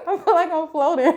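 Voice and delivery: fast laughing words; giddy laughing. Real, not acted. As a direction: giggle it out quickly. Giddy after the adjustment.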